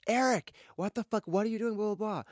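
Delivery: high-pitched mock yell; retold yelling. Yelling after a boyfriend storming off, retold as a mock shout. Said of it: "I'm yelling across the Americana".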